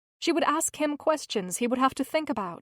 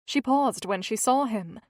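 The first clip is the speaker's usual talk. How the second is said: plain reading voice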